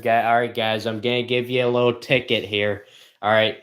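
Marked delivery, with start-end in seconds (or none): none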